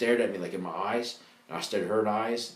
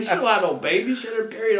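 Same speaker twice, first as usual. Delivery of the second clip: mocking classmates; 12 semitones higher, 6 dB louder than usual. Classmates correcting him.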